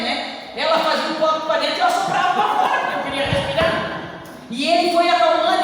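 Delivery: high, loud testimony, pressing hard to the church; fervent preaching. Acted. Testifying to the brothers in church, fervent loud preaching. Testimony told at full force.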